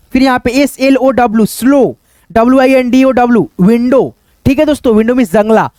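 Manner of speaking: ordinary delivery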